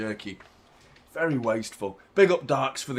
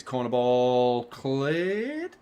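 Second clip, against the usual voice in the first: slow, rising on cleared; questioning rise; 4 semitones lower, much slower than usual. Shown as a demonstration. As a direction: drag it out, lifting on cleared. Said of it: "Question mark?".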